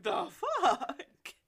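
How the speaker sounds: high, loud, slow outburst cracking with laughter; laughing disbelief. Retold or imitated. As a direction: exclaim incredulously through laughs. Appalled reaction through laughter.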